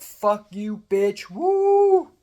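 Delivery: rising into a high, loud whoop; re-enacted scream. Scam victim replaying their outburst, mock scream. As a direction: act out screaming at the top of your lungs. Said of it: "I screamed on top of my lungs".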